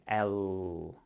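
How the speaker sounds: "L" held long to stand out; emphasized L sound. Shown as a demonstration. Teacher really emphasizes that sound, long-held "L". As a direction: draw out the l in "L". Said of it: "to really emphasize that sound".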